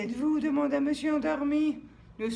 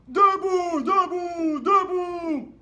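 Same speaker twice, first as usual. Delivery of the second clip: shouting, over and over; loud shout; 6 semitones higher, 11 dB louder, much slower than usual. Acted. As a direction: bellow each word to wake him. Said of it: "ajoutait une autre voix".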